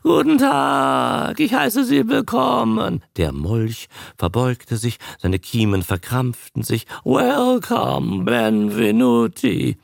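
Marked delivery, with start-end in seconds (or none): 0.0-3.0 a tired trained newt greeting the audience; croaking newt. Acted. The newt speaks. 7.0-9.8 slow, loud, rasping croak; a tired trained newt greeting the audience. Acted. The newt speaks.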